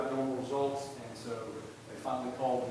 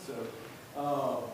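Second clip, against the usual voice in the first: ordinary talk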